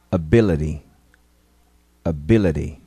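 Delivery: Ability with the final T tapped; flapped T. Shown as a demonstration. Teacher models Ability twice, flapped T. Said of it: "Ouça e repita".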